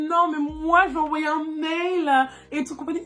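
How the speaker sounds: mocking prissy girls. Mocking girls who only send emails, put-on voice. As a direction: mimic the fussy girls she looks down on. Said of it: "les filles qui sont en mode".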